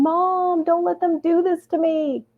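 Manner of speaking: high-pitched mock wail for mom; imitated yelling. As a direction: plead in a high, stretched-out mock yell. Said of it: "And he's yelling".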